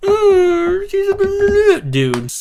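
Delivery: very high, slow, mock crying voice. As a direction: squeal it out like a fake sob.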